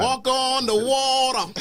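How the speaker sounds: high, slow, laughing preacher cry; mock preacher. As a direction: stretch each word like a preacher hamming it up.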